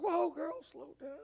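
put-on high, teasing whoa, girl, pulling back; a jokey put-on of shock; mock alarm. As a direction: playfully rein in an overeager wife. Telling off his churchy wife.

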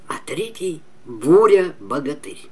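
usual speaking voice

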